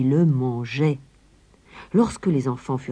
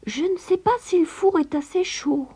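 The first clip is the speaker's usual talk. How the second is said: put-on much higher small girl's voice; little Gretel; 14 semitones higher than usual. A narrator's reading. Gretel tricking the witch at the oven, high innocent girl. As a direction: sound innocent and unsure. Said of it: "demanda Gretel".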